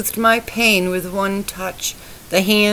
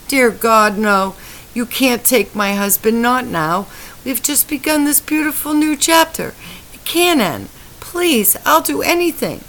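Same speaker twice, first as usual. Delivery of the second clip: much higher, pleading prayer; desperate prayer; 6 semitones higher than usual. A narrator's reading. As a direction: beg God for his life. Wife prays for dying husband.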